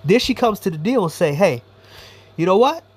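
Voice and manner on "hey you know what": ordinary talk, nothing marked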